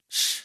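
firm, loud "shh"; hushing shush. Acted. Preacher as Jesus stilling the storm, hushing shush. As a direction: shush it with one breath.

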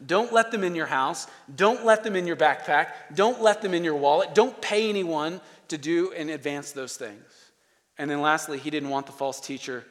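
ordinary talk, nothing marked

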